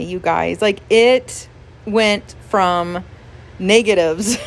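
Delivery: normal talking voice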